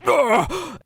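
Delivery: high, loud groan of pain; acted cry of pain; pained grunt. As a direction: groan as if being crushed. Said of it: "Alexander Forsythe just goes".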